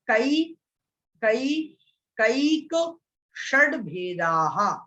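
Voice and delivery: slow, deliberate recitation with long gaps between words; separated word by word. Shown as a demonstration. Teacher repeating the sutra for careful learners, word by word. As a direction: pronounce each piece on its own, drawn out. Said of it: "बहुत अलग अलग करके".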